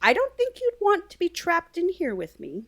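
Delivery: put-on high, menacing old-woman voice; high-pitched witchy warning; mysterious woman's voice. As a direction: put on a high voice for an ominous stranger. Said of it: "hear from behind them a woman say".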